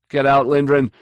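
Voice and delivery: loud, urgent warning; urgent loud warning to flee. A narrator's reading. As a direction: shout a warning to get out. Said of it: "he warned".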